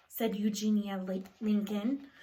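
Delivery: plain reading voice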